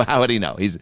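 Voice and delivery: normal talking voice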